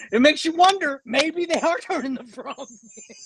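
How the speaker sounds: high-pitched, real giggles creeping in; trying not to laugh. Real, not acted. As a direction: struggle to get it out through laughter. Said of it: "I can't even say it without laughing".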